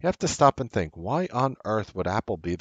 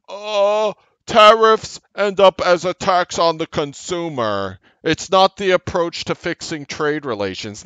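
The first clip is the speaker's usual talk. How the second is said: higher, louder, slower mocking corporate read; mock quoting Apple; 9 semitones higher, 6 dB louder than usual. Host mockingly quoting Apple's new earnings line, high and loud. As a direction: parody the company line as if it's absurd. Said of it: "fast forward to this earnings".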